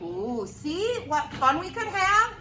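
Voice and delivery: ordinary delivery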